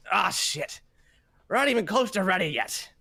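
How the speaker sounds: put-on high, startled old janitor yelp; startled old man. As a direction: caught off guard, an old man grumbling in alarm. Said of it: "and he's like".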